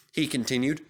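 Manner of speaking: ordinary delivery, nothing marked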